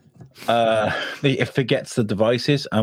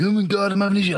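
high-pitched imitation of a flustered colleague; mock panic; 11 semitones higher, faster than usual. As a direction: squeal it in pretend distress. Retells colleague's panic over microphone.

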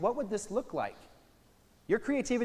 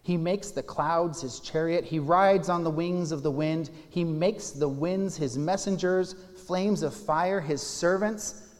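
loud, higher, slowing toward the end; proclaiming scripture; 4 semitones higher, 9 dB louder than usual. Acted. Pastor reads the psalm's images of wind and fire aloud, loud and slowing. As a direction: draw out the last image slowly and grandly.

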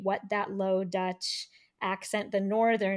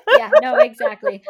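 much higher and louder, laughing through it; laughing agreement; 14 semitones higher, 16 dB louder than usual. Real, not acted. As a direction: laugh out your yes.

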